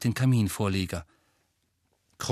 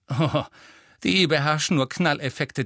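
scornful, higher-pitched dismissal; disdainful scoff; contemptuous; 4 semitones higher than usual. A narrator's reading. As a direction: sneer at their showy tricks. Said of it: "sagte Hermine verächtlich".

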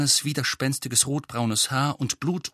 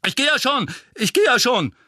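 high, loud, hurried giving-in; flustered, pleading shout; yelping surrender; 12 semitones higher, 5 dB louder than usual. Acted. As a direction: cry out to stop the beating. Mundungus gives in under attack.

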